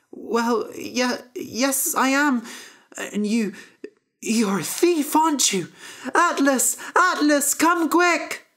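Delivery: high harp voice rising to a call; stammer, then call out high for the giant; harp calling for help. Acted. Harp accuses Jack and calls the giant, high and urgent.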